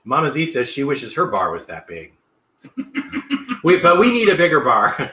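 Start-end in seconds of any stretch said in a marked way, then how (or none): 2.6-3.6 high burst of laughter. Real, not acted. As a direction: laugh high and breathy at the joke. Laughing at Mama Z's wish.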